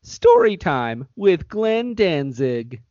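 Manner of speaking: put-on bright, slow announcer voice for kids; kids show host. Host announcing story time to kids, slow and bright. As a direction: introduce the segment to the children.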